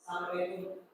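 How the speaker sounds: low, soft, offhand salam; cool husband greeting. Shown as a demonstration. Husband coming home, aloof and cool. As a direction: greet her flat and cool, barely bothering. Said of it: "kalau yang cool".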